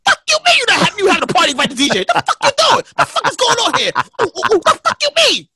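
high, shouted Brooklyn outrage; hammed-up Brooklyn yell, pitch way up. DJ acts out his angry DM to the promoter in a Brooklyn voice. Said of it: "He's reading this in my Brooklyn voice".

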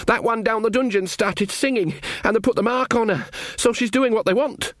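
put-on high-pitched panicky young soldier voice; frightened Sean Ogg. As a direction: blurt it out high, reporting to the queen. Narrator voices frightened Sean in a story.

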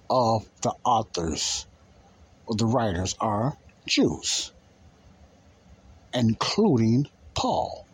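ordinary talk

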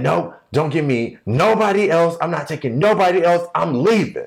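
high-pitched mock refusal; arguing waitress. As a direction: act out a fed-up waitress digging in. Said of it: "the hostess and one of the waitresses were arguing".

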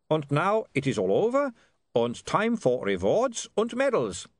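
high-pitched, cheerful Germanic vampire. Acted. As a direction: announce it brightly in a comic vampire accent. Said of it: "said the vampire cheerfully".

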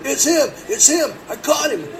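high loud accusing cry; accusing old man. Retold or imitated. Old owner shouting at the suspect: high loud accusation. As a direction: jab the finger, high and loud. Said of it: "old man accuses".

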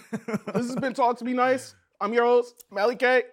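loud, high show outro; host sign-off. Acted.

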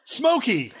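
gleeful high ooh breaking into a laugh; thrilled to blurt out a mascot name; excited. Retold or imitated. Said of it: "I got very excited".

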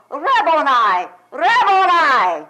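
high, loud, drawn-out joyful call; Mary recognizes the risen Christ and cries out; joyful cry. Acted. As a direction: cry out in sudden joy. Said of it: "this joyful cry".

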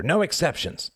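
high, decisive exclamation; emphatic cry. A narrator's reading. Badger overruling nervous Mouse, emphatic high cry. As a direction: lay down the rule in a high voice. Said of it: "cried Mister Badger".

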